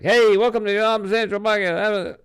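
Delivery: high, loud, gushing podcast welcome; excited happy voice. Shown as a demonstration. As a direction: put on an over-the-top happy host voice. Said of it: "voice where I'm all happy about".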